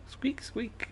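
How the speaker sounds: high whispered squeak, mimicking a mouse. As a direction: breathe out a tiny mouse squeak. Hearing a mouse in the garage.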